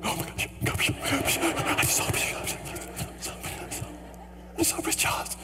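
breathless, hammed-up whisper of disbelief; starstruck whisper. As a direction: whisper it like a giddy fan. Reenacts starstruck walk away.

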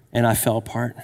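ordinary delivery

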